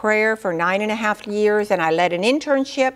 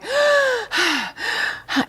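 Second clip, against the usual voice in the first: high, breathy gasp between sobs, retold; retold sobbing gasp; 13 semitones higher than usual. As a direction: imitate a man gasping as he weeps. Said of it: "crying and crying and going".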